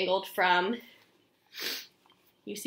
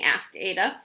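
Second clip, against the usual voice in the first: ordinary delivery, nothing marked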